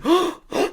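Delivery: sharp high mock gasp. Recapping the movie's ending to a friend, mock gasp. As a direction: gasp as if shocked by the twist.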